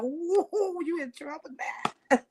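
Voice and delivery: high, soft teasing woo; a hint of playful alarm; mock alarmed bystander. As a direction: tease like someone just got cussed out. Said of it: "'cause I be like".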